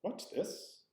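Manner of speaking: high-pitched, quick-rising question; alternative reading. Shown as a demonstration. Teacher showing how it could be asked, high and questioning. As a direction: pitch it high at normal volume. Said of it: "It could be".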